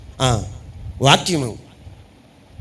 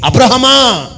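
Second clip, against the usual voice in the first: higher, louder divine call of అబ్రహమా; God calling; 7 semitones higher, 6 dB louder, slower than usual. Pretend. God calls out to Abraham, booming call.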